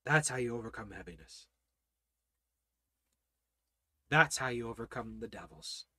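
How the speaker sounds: low, very soft, with long pauses; hushed preaching. Real, not acted. Preacher telling the congregation how to overcome depression, hushed preaching. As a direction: drop right down and let each phrase settle.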